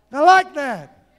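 loud, slow, drawn-out approval; emphatic approval. Acted. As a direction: belt it out slowly to the congregation. Same approval repeated loudly, drawn out.

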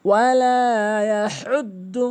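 loud opening, slow drawn-out vowels; Quran recitation. Shown as a demonstration. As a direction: chant it as a model reading. Model recitation of next verse. (জোরে টেনে তিলাওয়াত)

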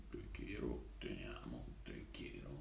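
soft breathy whisper of love words. Acted. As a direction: whisper it tenderly, very quiet. Repeats love words, then whispers.

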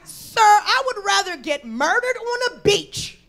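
high, loud, slow indignation; indignant rebuff. Retold or imitated. Comic answering the New Jersey couple, indignant rebuff. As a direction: declaim it in mock outrage.

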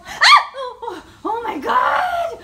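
high, slow, laughing shout of fright; frightened shriek. Real, not acted. Spider startles her, shouting in fright. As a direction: cry out half laughing in fear.

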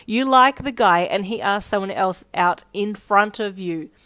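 loud, emphatic disbelief; scoffing. Acted. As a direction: scoff at the very idea. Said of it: "She looked at you and snorted".

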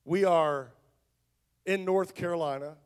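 ordinary delivery

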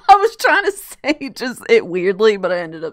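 loud laughing speech. Real, not acted. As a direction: talk through a laugh. Laughing at own slip.

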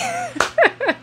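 short, high laugh at her own joke; bright laugh. Real, not acted. Preacher laughing at her own playful prayer, short high laugh. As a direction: laugh it off, amused.

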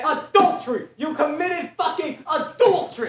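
high loud furious accusation; angry shout. Acted. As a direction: hurl the accusation. Acted accusation.

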